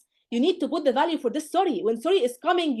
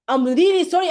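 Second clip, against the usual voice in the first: louder, higher, hammed-up sympathy; overdone mock sympathy; 6 semitones higher, 7 dB louder than usual. Teacher mocks a student over-apologizing for a cough, loud and dramatic. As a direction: pour on fake sorrow. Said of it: "why are you putting all of this effort".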